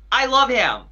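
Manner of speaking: high-pitched, falling delivery; imitated idol declaration. Fan relays idol's message for friend, high imitated voice. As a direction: start high and let it fall, lightly voicing the idol.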